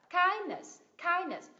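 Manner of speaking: "kindness" as KIND-ness, KIND high and loud; teacher's model of "kindness", weight on KIND; stress on first syllable. Shown as a demonstration. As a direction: punch KIND, let "ness" fall. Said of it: "Nhấn tiết thứ nhất".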